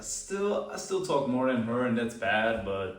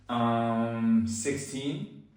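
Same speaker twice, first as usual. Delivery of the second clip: hesitant, unsure; much slower than usual. Shown as a demonstration. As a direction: answer unsurely, SIXTEEN lifting at the end. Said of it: "you sound stupid when you say it like that".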